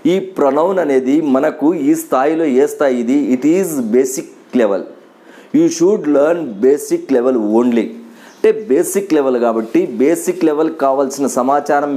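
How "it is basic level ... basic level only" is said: each English word said slowly and deliberately. Shown as a demonstration. Teacher to Telugu students, stating the level in slow English. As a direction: spell it out word by word for the class.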